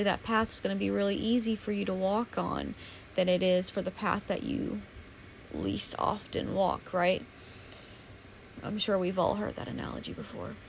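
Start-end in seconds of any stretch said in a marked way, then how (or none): none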